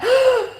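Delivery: loud high gasp of sudden realization; sharp gasp. Retold or imitated. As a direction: gasp as it all clicks. Rereading old journal entries.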